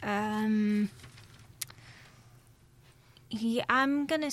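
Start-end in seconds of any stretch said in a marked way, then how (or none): none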